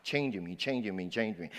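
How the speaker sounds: quiet, falling repetition of changing me; soft repeated refrain. Acted. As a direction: let changing me fade each time.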